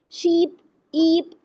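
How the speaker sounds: शीप with the ee stretched long and slow; long ee demo. Shown as a demonstration. Teacher showing students the long vowel, stretched ee. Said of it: "देखिए ई यो में highlight कर रही हूँ".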